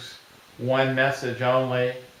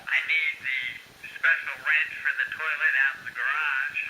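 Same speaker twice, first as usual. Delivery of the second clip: softer, tinny small-speaker recording; like a memo gadget speaking back to you; played back from the device; 5 dB quieter than usual. Shown as a demonstration. Handyman hearing his recorded reminder in the garage, tinny playback. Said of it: "So you hit the playback".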